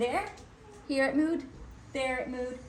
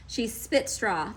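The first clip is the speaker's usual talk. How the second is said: plain reading voice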